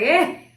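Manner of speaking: high, loud staccato giggle; sudden hammed-up cackle; imitated laughing fit. Switching from crying to laughing at the clinic, mock laugh. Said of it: "to laughing. Now I was like".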